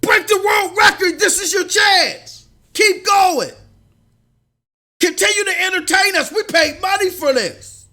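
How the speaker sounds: high, loud mock crowd shouting; imitating a yelling crowd. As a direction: bellow at the runner like an angry stadium. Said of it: "the people in the crowd under that scenario be like".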